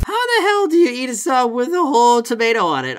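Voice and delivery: can't believe this order; incredulous rant. Real, not acted. Worker ranting at the absurd whole tomato order, high and incredulous.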